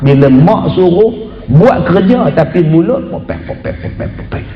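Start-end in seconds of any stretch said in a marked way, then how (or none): 3.1-4.4 unvoiced grumbling babble; muttered sulky grumble from a chore-dodging child. Shown as a demonstration. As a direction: mumble a stream of complaints under your breath. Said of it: "suara yang mengomel".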